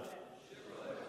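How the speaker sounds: ordinary talk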